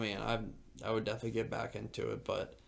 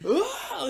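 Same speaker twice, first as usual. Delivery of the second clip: piercing high scream, much louder; imitated climber's scream; 9 dB louder than usual. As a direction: yell like a climber lunging for a hold. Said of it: "He's just screaming".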